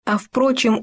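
ordinary delivery, nothing marked

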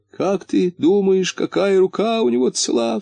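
high-pitched, lightly tearful voice; sobbing question. A narrator's reading. Муфта asks his friend about the hand, mildly sobbing. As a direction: ask plaintively, as if through sobs. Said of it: "всхлипывая, спросил муфта".

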